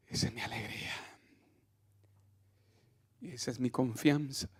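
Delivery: soft, prayerful sermon voice; hushed preaching. Acted. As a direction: drop to a near murmur to draw them in. Hushed intimate sermon passage.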